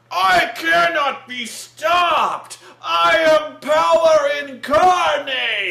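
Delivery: drawn-out, high, hammed-up villain shout; megalomaniac villain. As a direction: roar each word slowly as a mock supervillain. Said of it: "he's like".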